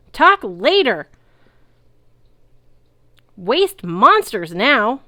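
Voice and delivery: loud high gung-ho yell; rally the troops mid-fight; loud, slow, hyped battle cry. A narrator's reading. Centaur yelling mid-battle.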